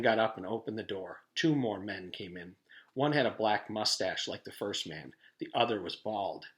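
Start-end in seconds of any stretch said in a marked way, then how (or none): none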